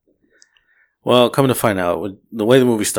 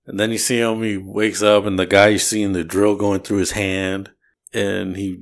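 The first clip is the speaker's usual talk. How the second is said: usual speaking voice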